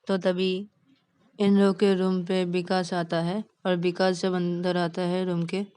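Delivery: ordinary delivery, nothing marked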